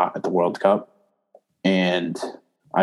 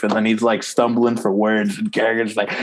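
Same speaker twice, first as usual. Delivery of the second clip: ordinary talk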